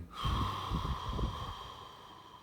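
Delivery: long, audible exhale that slowly fades; big sigh. Shown as a demonstration. Meditation guide showing listeners how to let go, big sigh. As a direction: let it all go in one big breathy sigh. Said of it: "letting go with a big sigh".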